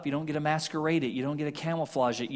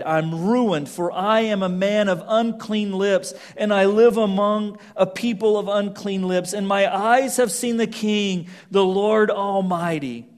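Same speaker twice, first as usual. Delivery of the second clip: crying out; 4 semitones higher, 6 dB louder than usual. A narrator's reading. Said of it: "I cried".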